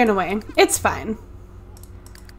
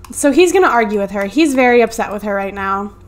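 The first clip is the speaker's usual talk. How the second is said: ordinary delivery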